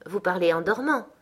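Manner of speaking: ordinary delivery, nothing marked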